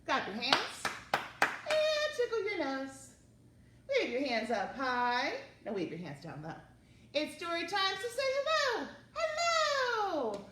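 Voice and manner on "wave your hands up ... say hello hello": ordinary talk, nothing marked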